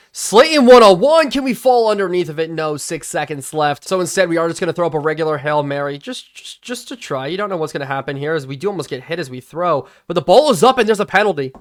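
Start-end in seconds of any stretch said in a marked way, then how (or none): 0.1-2.5 loud, high shout of hope; urgent, raised-pitch sports cry; excited play call. Real, not acted. Calling a last-seconds deep throw. 10.1-11.5 loud, raised-pitch live call; urgent game commentary; excited play call. Real, not acted. Live call of a last-seconds play.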